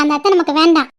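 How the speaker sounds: usual speaking voice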